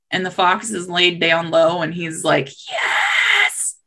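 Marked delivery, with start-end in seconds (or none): 2.7-3.8 very high, stretched squeal of triumph; gleeful gloating. Acted. Hidden fox sees his trap working, gleeful gloating. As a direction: celebrate secretly in a tiny high voice.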